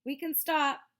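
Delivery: high, loud call from ahead; yell. A narrator's reading. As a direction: shout back to the group. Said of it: "Miles yelled from farther up".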